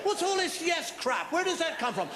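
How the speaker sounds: ordinary delivery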